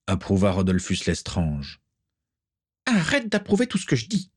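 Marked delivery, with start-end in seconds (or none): 2.9-4.3 high spitting witch snap; spat. Acted. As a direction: spit the words at him. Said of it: "cracha Bellatrix".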